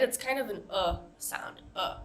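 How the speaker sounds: short relaxed neutral "uh"; a lazy neutral "uh" vowel; relaxed schwa. Shown as a demonstration. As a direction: say "uh" with the mouth loose and neutral. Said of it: "Very relaxed, very neutral vowel sound".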